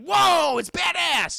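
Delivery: loud, high whoop of admiration; awed excitement. Retold or imitated. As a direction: exclaim it like a thrilled onlooker. Voices awe at the shootout.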